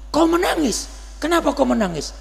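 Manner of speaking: pertanyaan tinggi dan keras, mendesak; tanya bertubi-tubi, suara naik tinggi; Nabi bertanya cemas. Acted. Nabi questions weeping Abu Bakar. (Nabi bertanya kepada Abu Bakar, keras dan tinggi)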